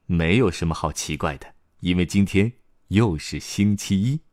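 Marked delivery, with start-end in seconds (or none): none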